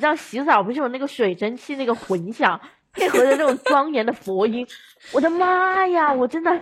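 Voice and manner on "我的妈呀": ordinary talk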